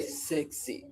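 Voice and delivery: near-whispered, badly faked Jamaican accent; hushed attempt at a Jamaican catcaller's accent; botched Jamaican accent. Streamer imitates catcalling Jamaican men, botched hushed accent. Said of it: "That's the wrong accent".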